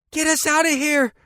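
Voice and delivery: put-on high, loud panicked child's scream. A narrator's reading. Girl fleeing gunfire in the car, panicked scream. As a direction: scream it in terror. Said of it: "Audrey screamed".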